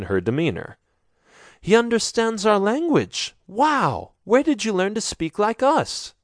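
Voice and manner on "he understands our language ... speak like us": put-on much higher, bright girl's voice; shy enthusiasm bubbling over; eager young woman. A narrator's reading. Shavana amazed that the stranger speaks their language. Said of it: "she asks".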